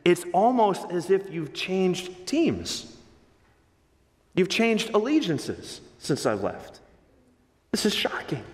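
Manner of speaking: slow, then dropping to a whisper; a hint of a stunned father; shocked. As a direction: build to a hushed shocked whisper. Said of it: "what Paul is saying".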